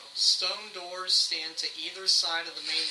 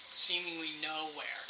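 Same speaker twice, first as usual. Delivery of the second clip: usual speaking voice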